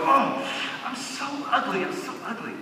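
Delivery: high, soft mock lament; lightly voiced woman moaning about her looks; self-pitying mother. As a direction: sigh it out like she hates her reflection. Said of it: "she would go".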